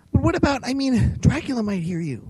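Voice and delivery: put-on high, stammering boyish voice; alarmed young Tim. A narrator's reading. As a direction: sputter in panic. Tim's voice, alarmed.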